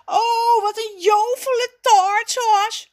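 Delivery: high, loud squeal of delight; delighted cry. A narrator's reading. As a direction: burst out thrilled in a high child-like voice. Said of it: "roept Raffie verrukt". (verrukt uitroepen, hoog en luid)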